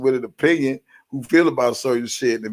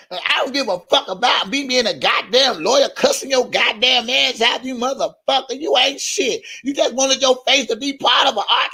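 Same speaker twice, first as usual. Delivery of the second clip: much higher mock tirade; mocking rant; 6 semitones higher than usual. Mocking a critic ranting at him on her channel, high and fast. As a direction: rattle it off as an unhinged critic.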